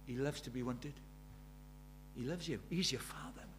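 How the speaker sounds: soft, low and tender; tender assurance. Real, not acted. Preacher assuring the congregation of God's love, tender. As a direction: quietly reassure them, voice dropped.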